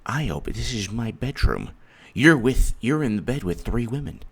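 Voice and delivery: a caught husband mumbling his defence; meek reply. Retold or imitated. Husband explains to angry wife, mock timid and soft.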